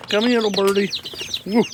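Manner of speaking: high, sing-song call to a chick; coaxing a wild bird. Real, not acted. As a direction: coax the little bird closer, high and sweet.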